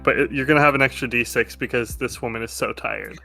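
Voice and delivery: usual speaking voice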